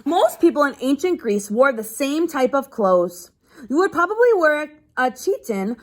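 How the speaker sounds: steady read-aloud to a class; reading to children. Acted. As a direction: read the page out to the kids. Teacher reads a book aloud.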